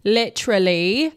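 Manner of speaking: slow Australian "Literally" with the vowels drawn out; playful Australian accent demo; mock Australian accent, said slowly. Shown as a demonstration. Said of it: "talking in Australian accents".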